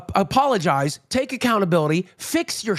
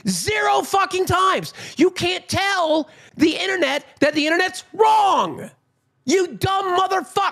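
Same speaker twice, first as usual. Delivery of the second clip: higher, angry yelling rant; real angry shout; 9 semitones higher than usual. Real, not acted. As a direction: shout him down in real anger. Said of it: "I'm so goddamn mad".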